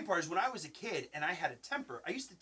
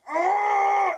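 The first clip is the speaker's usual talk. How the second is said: loud high shouted cry of rage; reenacting his childhood anger, a loud high cry; angry kid cry; 15 dB louder than usual. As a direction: yell it out like a furious child with his knuckle in his mouth.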